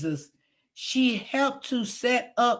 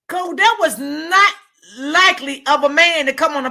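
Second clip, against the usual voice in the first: louder and higher, scolding the man on the live; 4 semitones higher, 6 dB louder than usual. Real, not acted. Vlogger scolding the man from the live, loud and high. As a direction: scold him loudly, high and hard.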